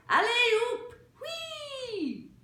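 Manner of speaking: very high, loud whoops; gleeful circus cries. A narrator's reading. La Gloria whooping as she jumps, very high. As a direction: whoop like a show-off rider. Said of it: "she cried".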